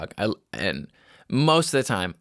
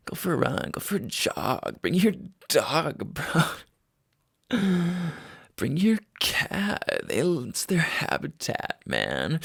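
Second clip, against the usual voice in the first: higher, drawn-out mock bro voice; mock douchebag bro; 6 semitones higher, slower than usual. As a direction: ham up the gym bro he just heard himself be. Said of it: "I sound like a douchebag".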